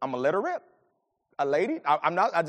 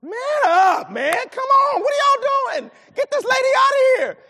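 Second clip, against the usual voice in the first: much higher and louder, yelling at the idle men; angry shout; 8 dB louder than usual. Retold or imitated. Preacher retelling yelling at men outside the restroom, furious shout. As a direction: bellow in frustration, get this lady out. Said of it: "And I'm like".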